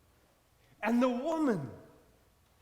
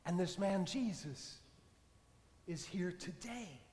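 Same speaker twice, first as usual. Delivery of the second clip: closing sermon blessing. Acted. Preacher closes the sermon.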